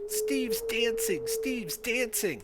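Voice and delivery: put-on high, drawn-out little boy cry; child's mock shout. Dancing boy announcing to everyone, high mock shouting. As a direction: call it out slowly in a high child's voice.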